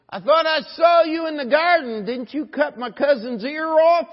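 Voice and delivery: very loud, high-pitched accuser; shouted accusation. Acted. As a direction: yell it like an outraged witness. Accuser of Peter voiced, acted challenge.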